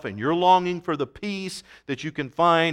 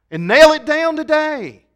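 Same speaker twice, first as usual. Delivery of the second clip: much higher and louder with a changed ring; fervent preaching shout; 13 semitones higher, 10 dB louder than usual. Pretend. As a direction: cry out "nail it down today". Climax of the model prayer.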